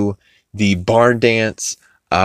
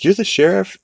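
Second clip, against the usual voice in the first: incredulous Lois; 12 semitones higher than usual. Retold or imitated. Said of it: "And Lois is like".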